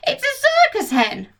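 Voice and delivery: put-on high, amazed old woman's cry; grandma shouting. Grandma shouting from the rooftop at the somersaulting hen. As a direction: marvel at the flipping hen. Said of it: "Grandma shouted from the rooftop".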